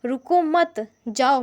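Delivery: रुको मत joined, a stop, then जाओ; pause after मत. Shown as a demonstration. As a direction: hold up रुको मत, break, then release जाओ. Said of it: "यहाँ पे ऊपर वाले में".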